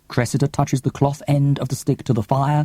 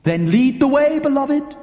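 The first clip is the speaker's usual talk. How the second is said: high, louder rallying call; bright high urging cry; eager call; 4 dB louder than usual. Acted. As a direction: cheer him on to lead. Cressy urges Milo on.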